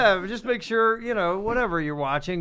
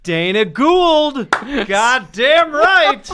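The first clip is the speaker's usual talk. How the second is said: loud, drawn-out, higher proclamation; emphatic endorsement; 6 semitones higher, 5 dB louder, slower than usual. Pretend. Host backing the foreword writer, mock-grand endorsement. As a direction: proclaim it slowly and loudly.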